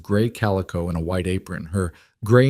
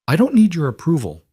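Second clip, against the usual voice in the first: sharp, higher-pitched retort; 8 semitones higher, 3 dB louder than usual. A narrator's reading. Indignant woman rebuffing the rider, sharply. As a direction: snap it back at him, indignant. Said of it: "she said sharply".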